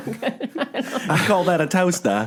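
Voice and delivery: put-on a laughing Crocodile Dundee boast in an over-the-top Australian accent; Crocodile Dundee impression. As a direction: stretch it out in a mock Australian voice while cracking up. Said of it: "Crocodile Dundee".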